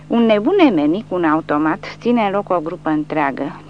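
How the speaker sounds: ordinary delivery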